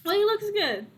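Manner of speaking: high-pitched uncle voice; high, encouraging uncle; approving uncle. Acted.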